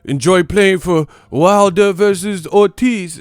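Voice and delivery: high, loud, slow mocking voice; mocking British fan. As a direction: parody the smug British fan. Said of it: "y'all talking about".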